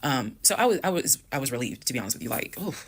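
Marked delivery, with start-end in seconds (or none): 2.6-2.7 gleeful relief. Retold or imitated. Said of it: "that's how I felt".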